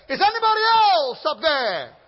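high, loud call upward; man calls out for a different helper; desperate comic shout. Acted. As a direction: yell the punchline skyward. Punchline, man calls again.

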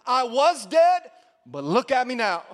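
loud, taunting "look at me now"; Jesus mocking the devil, loud; mocking boast. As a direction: gloat at the devil. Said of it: "he kinda mocks the enemy".

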